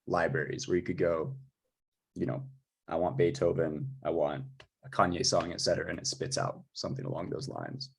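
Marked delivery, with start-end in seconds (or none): none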